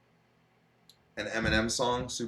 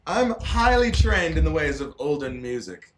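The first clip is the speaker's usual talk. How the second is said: much higher, louder, slowed speech through laughter; laughing brag; 7 semitones higher, 9 dB louder, slower than usual. Real, not acted. Bragging to his friend about old music, laughing. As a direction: brag while cracking up.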